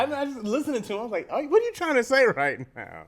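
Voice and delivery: loud bafflement. Retold or imitated. Retelling own baffled reaction, loudly.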